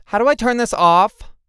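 loud, high-pitched frantic complaint; exasperated outburst. A narrator's reading. Lulu trying to turn off the comms, exasperated outburst. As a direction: cry out over the voice in your ears.

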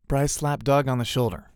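ordinary delivery

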